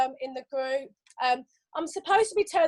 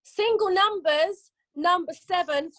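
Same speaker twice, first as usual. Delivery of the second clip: slow sing-song number calling; bingo caller; 3 semitones higher, slower than usual. Acted. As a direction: call each number drawn out.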